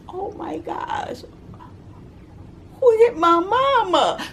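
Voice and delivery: put-on high, slow, shaken child's cry; a little kid scared for mom; frightened child.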